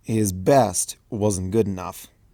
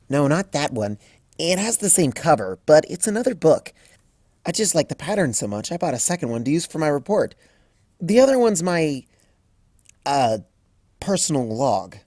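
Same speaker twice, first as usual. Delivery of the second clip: put-on a lightly raised, eager young mare; Twilight voiced; 6 semitones higher than usual. A narrator's reading. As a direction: read Twilight a touch higher, briskly. Twilight's voice in story.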